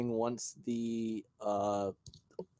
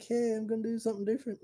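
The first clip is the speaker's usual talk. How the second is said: excited; 13 semitones higher, faster than usual. Retold or imitated. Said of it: "I was like".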